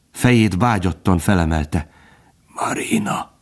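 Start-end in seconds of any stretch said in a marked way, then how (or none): 2.5-3.3 weak, whispered call; voiceless, effortful plea; strained. A narrator's reading. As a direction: barely get the name out in a whisper. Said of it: "mondta erőlködve".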